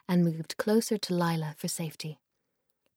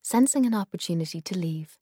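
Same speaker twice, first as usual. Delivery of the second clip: plain reading voice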